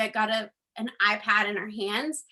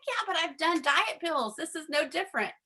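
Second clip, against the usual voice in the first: higher-pitched mock objection; higher voice, arguing back at advice; retold protest; 5 semitones higher than usual. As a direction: push back in a high, whiny-skeptical retelling. Acted protest to her upline.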